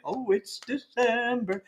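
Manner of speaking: slow, drawn-out delighted voice; a light imitation of an eager holiday fan; excited holiday fan. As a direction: savour it like a holiday lover. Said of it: "Jess Mendoza's thinking".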